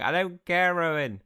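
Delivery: high, very loud retort; impassioned high-pitched shout; shouted outburst. Acted. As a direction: yell it over his big reveal. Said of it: "And I'm like".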